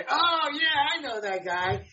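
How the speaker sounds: sudden high "oh yeah" of recognition; light up as you recognize him; surprised recognition. Retold or imitated. People recognizing a face.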